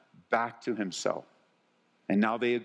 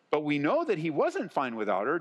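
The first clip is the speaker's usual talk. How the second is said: ordinary talk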